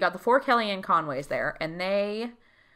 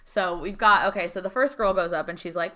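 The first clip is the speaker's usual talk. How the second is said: ordinary talk, nothing marked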